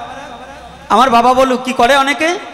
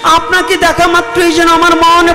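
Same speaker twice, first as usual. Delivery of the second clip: raised, imploring devotee's voice; pleading devotee; 7 semitones higher than usual. Acted. Devotee begging the lord for a way back, pleading. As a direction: beg humbly for an answer. Subuddhi pleads before Mahaprabhu.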